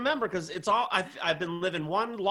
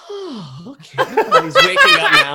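high, loud, fast sing-song cheer; hammed-up bright morning greeting; mock cheerful wake-up; 11 semitones higher, 12 dB louder than usual. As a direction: chirp it like a morning person. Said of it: "just going like".

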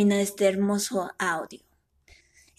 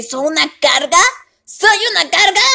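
rising louder; 7 semitones higher, 7 dB louder than usual. Retold or imitated.

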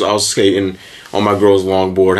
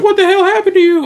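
very high mock-shocked question; high exclaimed retelling; imitated friend's alarm; 4 dB louder than usual. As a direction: mimic a startled friend, pitched way up. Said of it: "So my boy's like".

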